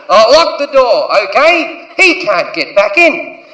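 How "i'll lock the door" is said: ordinary delivery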